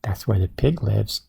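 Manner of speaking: ordinary delivery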